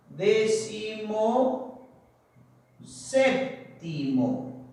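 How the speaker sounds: very slow, drawn-out syllables; dictating slowly. Shown as a demonstration. Teacher writing out the example for students, dictating slowly. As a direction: stretch each word out, long pause between.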